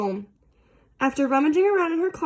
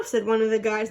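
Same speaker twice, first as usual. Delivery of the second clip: ordinary delivery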